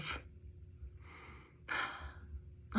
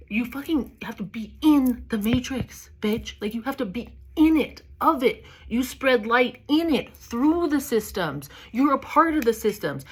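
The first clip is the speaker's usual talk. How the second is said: ordinary talk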